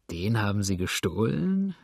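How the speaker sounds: ordinary delivery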